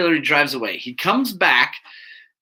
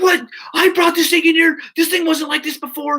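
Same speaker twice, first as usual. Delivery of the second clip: high-pitched outraged complaint, loud at first; mock screaming; 12 semitones higher, 3 dB louder than usual. Customer blaming the mechanic for the bike, mock screaming. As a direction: imitate a customer ranting in protest. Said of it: "so he's screaming at me".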